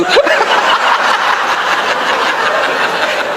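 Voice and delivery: a high, voiceless wheezing laugh; breathless laugh. Real, not acted. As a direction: laugh silently till the breath squeaks. Laughs at own wife joke.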